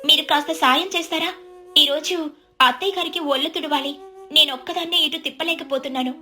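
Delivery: put-on high, meek young wife voice; explain, then politely ask for help; pleading daughter-in-law.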